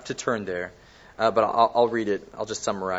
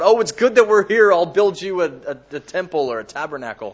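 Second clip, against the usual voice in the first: higher, louder impulsive outburst, easing off at the end; blurting out; 4 semitones higher, 6 dB louder, faster than usual. Retold or imitated. Preacher acting out impulsive Peter to the congregation, blurted out. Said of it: "he just blurts out".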